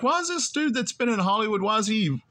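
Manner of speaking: high, incredulous whine; mock incredulous. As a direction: squeak it out in disbelief. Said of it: "I remember having this thought".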